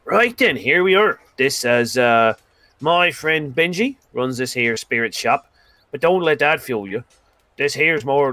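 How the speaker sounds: a swaggering loudmouth boasting to his pals; boisterous Demonarin. Acted. GM voices Demonarin.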